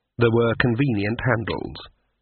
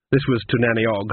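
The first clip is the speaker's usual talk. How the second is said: usual speaking voice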